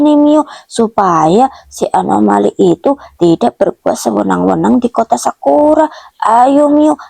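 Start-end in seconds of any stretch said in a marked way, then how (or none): none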